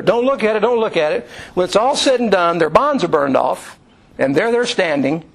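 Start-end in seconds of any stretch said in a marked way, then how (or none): 0.0-1.3 mild mock yell, pitched up; raised pitch, insistent, not truly shouted; retold yelling. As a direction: imitate a repeated urgent warning. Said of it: "he's still yelling at her".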